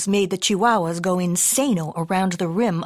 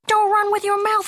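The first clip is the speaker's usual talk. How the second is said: high scolding holler; hollering mama; 13 semitones higher, 4 dB louder than usual. A narrator's reading. Mama warning kitty with his mouth full, hollering. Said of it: "hollered Mama".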